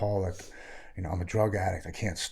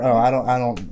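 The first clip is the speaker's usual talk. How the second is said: usual speaking voice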